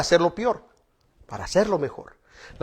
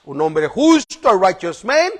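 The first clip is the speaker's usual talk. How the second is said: ordinary talk, nothing marked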